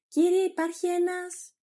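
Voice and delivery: high, loud announcement; calling out. Acted. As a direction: burst in and call to the master. Maid bursts in announcing a visitor.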